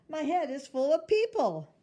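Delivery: high, loud proud declaration; proud child voice. Acted. Girl tells her mother proudly, high and loud. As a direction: announce it like a happy little girl.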